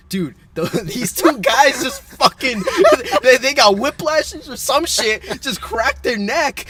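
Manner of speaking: laughing while telling, slowed. Retold or imitated. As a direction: giggle through the words. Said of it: "and I'm just like".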